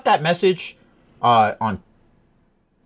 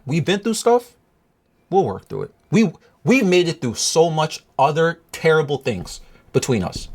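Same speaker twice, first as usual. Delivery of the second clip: ordinary talk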